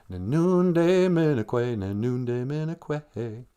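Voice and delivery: "Nin nunde minekwe" slow, each syllable clear; slow teaching demo. Shown as a demonstration. To the kids, teaching how to say I'm thirsty, slow. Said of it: "you'd say".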